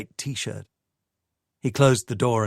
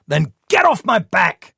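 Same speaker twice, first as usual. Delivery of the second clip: loud, higher furious shout; 9 semitones higher, 7 dB louder than usual. Acted. Michael snapping at Sadie, loud furious shout. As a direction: bark it out, slamming the table. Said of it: "he shouted".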